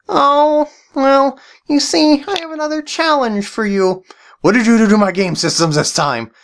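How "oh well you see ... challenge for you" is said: high, sly villain voice; evil voice guy. Acted. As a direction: scheme like a cartoon baddie. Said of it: "why did you call me evil voice guy".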